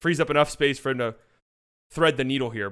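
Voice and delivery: ordinary talk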